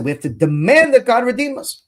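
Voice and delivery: preaching at full force; fervent demand. Real, not acted. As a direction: press the demand home. Sermon climax, demanding redemption.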